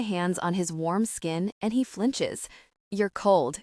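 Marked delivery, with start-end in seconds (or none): none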